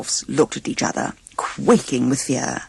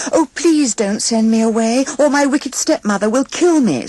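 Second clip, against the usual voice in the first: tearful plea; 9 semitones higher, 4 dB louder than usual. A narrator's reading.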